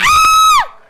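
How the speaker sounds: shrill, full-throated horror scream; sudden high shriek; piercing scream. Acted. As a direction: scream out the window in shock. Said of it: "looked out of the window and screamed".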